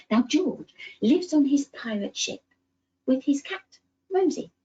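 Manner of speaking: lively, animated storybook voice; reading to children. Acted. Storyteller reading George's adventure to little ones, animated. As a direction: tell it like a bedtime tale full of wonder.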